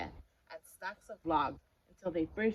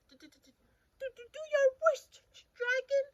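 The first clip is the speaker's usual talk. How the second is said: very high, fast stuttering squeak; stammering scared knight; 5 dB louder than usual. Acted. Frightened knight daring the dragon. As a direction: stammer in terror. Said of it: "scared knight stuttering".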